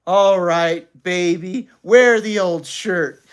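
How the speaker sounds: slow, loud, grudging surrender. A narrator's reading. Father giving in to sobbing mother, grudging. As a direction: concede it with mild scorn.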